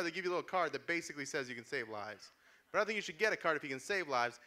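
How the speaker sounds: usual speaking voice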